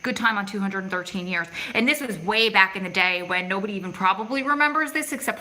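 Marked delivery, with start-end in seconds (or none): none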